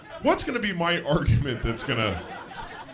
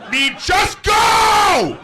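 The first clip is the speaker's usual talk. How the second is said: high, very loud road-rage screaming; hammed-up furious motorist yell; screaming driver; 8 dB louder, much slower than usual. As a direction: scream it out the car window, dragging the last word. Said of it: "Screaming out his window at me".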